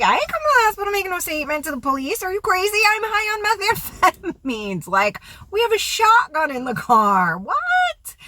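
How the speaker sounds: high-pitched acted disbelief; incredulous refusal. Teller refusing hospital trip to caller, acted high-pitched incredulity.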